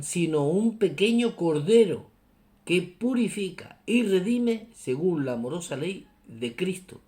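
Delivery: loud, high, declaimed reading; emphatic reading aloud. Real, not acted. Preacher rereads a Pope's words to listeners, emphatic and solemn. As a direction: proclaim it phrase by phrase, punching key words.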